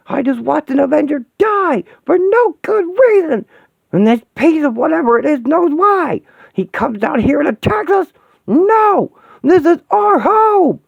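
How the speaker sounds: high, loud, raging screams; furious screaming. Acted. She-Hulk screams at the broken Vision in rage. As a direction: tear into him with fury and grief.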